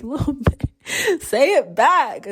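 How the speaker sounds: high, drawn-out playful demand; mock scolding. Adult demanding love back from inner teenager, mock scolding. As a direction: stretch it high in a joking telling-off.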